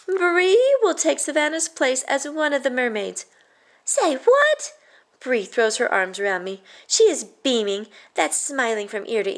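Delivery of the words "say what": high, loud disbelief; stunned say what; shocked. Acted. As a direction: squeal it in surprise. Shocked at startling news.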